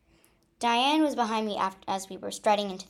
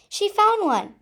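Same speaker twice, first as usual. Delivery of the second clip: excited; 11 semitones higher, 8 dB louder than usual. A narrator's reading. Sudden happy news.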